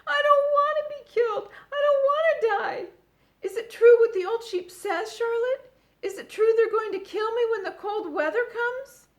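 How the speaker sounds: very high, loud sobbing shout; crying scream. A narrator's reading. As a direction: wail in full panic. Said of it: "screamed Wilbur".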